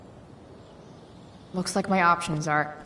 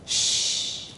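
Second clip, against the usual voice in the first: hushed shush; whisper. Acted. Shushing someone quietly.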